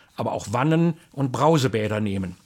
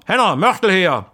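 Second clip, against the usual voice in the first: loud, higher shout upward; calling up; 5 semitones higher, 8 dB louder than usual. Retold or imitated. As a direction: bellow for mortar from below. Foreman calls up the ladder.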